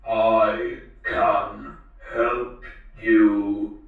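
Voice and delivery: very slow monotone robot, no intonation; mechanical robot voice. A narrator's reading. The talking robot answers the girl, toneless mechanical voice. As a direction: drag out each word like a machine. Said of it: "a mechanically timbered voice".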